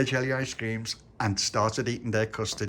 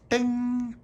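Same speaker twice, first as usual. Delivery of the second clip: bus bell ding. Acted.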